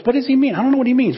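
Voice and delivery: a confused follower throwing up his hands; frustrated disciple. Retold or imitated. Preacher imitating a frustrated disciple, high and exasperated. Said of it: "I'm sure some of them were frustrated".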